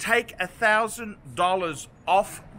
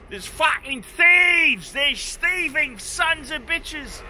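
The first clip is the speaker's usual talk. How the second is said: slow, louder, higher outraged shout; 6 semitones higher, 3 dB louder, slower than usual. Real, not acted. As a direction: bellow it, dragging every word out. Ranting about UK thieves.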